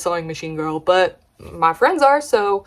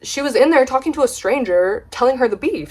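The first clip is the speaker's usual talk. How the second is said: normal talking voice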